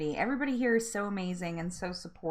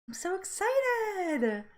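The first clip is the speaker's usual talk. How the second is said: high, slow gushing excitement; excited; 9 semitones higher, slower than usual. Real, not acted. Host thrilled by ten early viewers, high gushing delight. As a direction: let the excitement lift it up.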